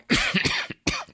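long harsh hacking cough; a cough you can't hold back; chesty cough. Real, not acted. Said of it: "mi continuo a scusare per la tosse".

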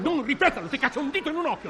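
high, heated threat; comic threat in a row; angry threat. Acted. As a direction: threaten him. Threat in quarrel.

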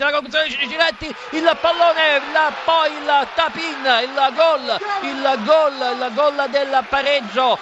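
rising shout as the ball goes in; excited goal call. Real, not acted.

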